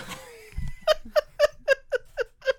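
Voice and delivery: shrieking laugh, very high; high squealing laugh. Real, not acted. Host cracks up mid-pitch.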